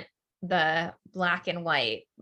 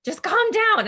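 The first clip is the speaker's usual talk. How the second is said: much higher, louder mock mom snapping calm down; imitating mom; 8 dB louder than usual. Grandma to upset Connor, mock exasperated calm down. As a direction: play grandma cutting off an upset child. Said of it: "my mom used to say to Connor".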